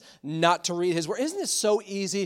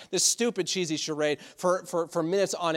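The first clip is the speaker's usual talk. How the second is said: ordinary delivery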